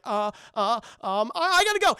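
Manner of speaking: high, loud, flustered bail-out; panicky excuse. Retold or imitated. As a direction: squeak it out and flee. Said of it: "this other guy's like".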